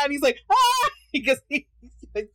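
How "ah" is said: very high, loud mock shriek; imitated scared dad scream. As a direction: shriek in startled mock terror. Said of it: "he's scared".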